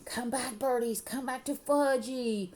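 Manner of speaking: put-on high, drawn-out toddler call; screaming toddler. A narrator's reading. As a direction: cry out slowly in a little boy's high voice. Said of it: "was screaming".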